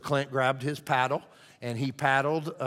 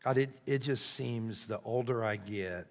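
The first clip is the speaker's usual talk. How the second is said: soft, halting spoken prayer; 3 semitones lower, 6 dB quieter than usual. Real, not acted. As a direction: pray quietly with long pauses. Leads a closing prayer.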